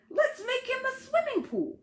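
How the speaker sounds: high, bright excited plan; thrilled imaginary friend pitching; eager Ted. Acted.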